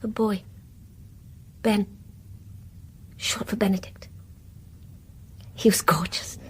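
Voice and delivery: put-on soft whispered memory of a baby; whispered, remembering the lost child; hushed tender whisper. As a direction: breathe it, fond and sad.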